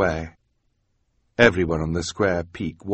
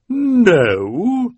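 much higher, louder squeak; high incredulous No?; astonished Esme; 8 dB louder than usual. A narrator's reading. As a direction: shriek of disbelief. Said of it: "in an astonished voice".

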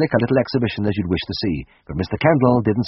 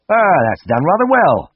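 pleased; 9 semitones higher, 6 dB louder than usual. Acted. Proud vet admires result.

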